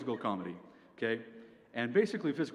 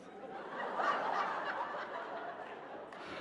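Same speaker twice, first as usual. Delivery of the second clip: long airy wheezing groan, voiceless; asthmatic goose noise; 4 dB quieter than usual. Shown as a demonstration. Comic showing the sound a body makes hearing puns, asthmatic goose wheeze. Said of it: "same sound as an asthmatic goose".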